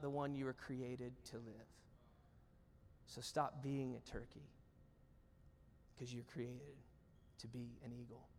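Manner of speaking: low, very soft, long pauses; hushed appeal. Real, not acted. Preacher appeals to congregation to be eagles, hushed. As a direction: let each phrase sink in gently.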